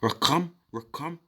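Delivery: Raquam said as "ruh-KUM", twice; demonstrated pronunciation. Shown as a demonstration. Teacher showing how it is actually said, Raquam as ruh-kum. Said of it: "the way you actually say it".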